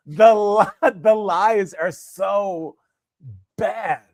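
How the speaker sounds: laughing. Real, not acted. Laughing at bad lies.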